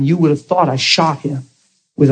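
ordinary talk

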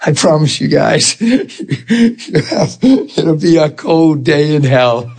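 slow, drawn-out words broken by real laughter; laughing. Real, not acted. Preacher promising the crowd he won't stay hurt, laughing slowly. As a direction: laugh your way through the promise.

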